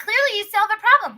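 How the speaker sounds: high, loud angry retort; retold heated comeback; confrontational. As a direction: fire it back at mom. Acted retort to mother in quarrel.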